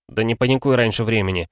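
ordinary delivery

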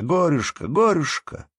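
high squealing taunt; piercing squeal. Acted. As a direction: squeal it out teasingly. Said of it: "пронзительно взвизгивая".

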